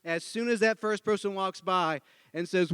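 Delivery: ordinary talk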